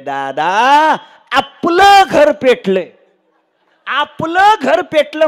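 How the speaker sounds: high, loud, drawn-out mock wail; tearful son. Eldest son tells father their own house burns, loud wailing. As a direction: wail the disaster out slowly, hammed-up. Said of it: "थोरलं पोरग रडतच आलं".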